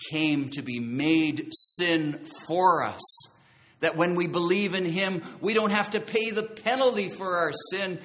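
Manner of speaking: loud, stretched-out key words, rising intensity; emphatic preaching. Real, not acted. Preacher explains conviction of sin, forceful and emphatic. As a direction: preach it with force, drawing out each point.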